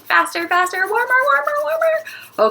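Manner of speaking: high, climbing excited chant; playful urging. Pretend. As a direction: urge them on, pitch rising with every word. Urging pretend dough warming faster.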